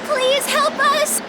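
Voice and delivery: high, pleading call; calling for help. Acted. Stranded hiker to whoever is in the cottage, calling for help.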